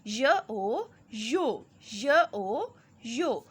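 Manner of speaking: put-on slow, drawn-out letter by letter reading for children; slow spelling drill. Shown as a demonstration. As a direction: sound out each letter slowly, then blend. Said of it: "same इसी तरह reading करके".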